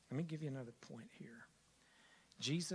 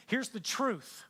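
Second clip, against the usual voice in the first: ordinary talk, nothing marked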